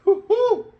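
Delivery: high, loud woo-hoo; excited whoop. Real, not acted. Fan celebrating his own dream finish with a high whoop. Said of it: "Let me catch my breath first".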